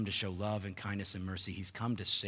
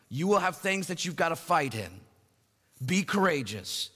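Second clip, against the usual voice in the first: loud, raised preaching of scripture; full-force preaching; 4 semitones higher, 5 dB louder than usual. Acted. Preacher proclaiming the verses to his congregation, loud and raised. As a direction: proclaim it to the whole room.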